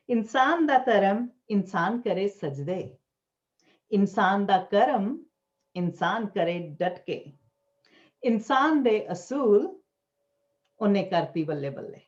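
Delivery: measured Punjabi verse with lilting line endings; poetry recital. Acted. Poet reading own Punjabi verse to gathering, measured recital. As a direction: declaim each line, pausing between phrases.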